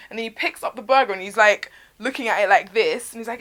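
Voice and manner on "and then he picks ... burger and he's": plain reading voice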